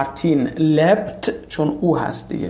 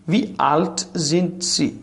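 slow model question; much slower than usual. Shown as a demonstration. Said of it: "teacher models German question".

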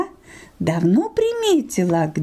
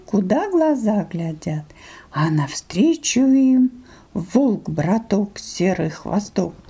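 usual speaking voice

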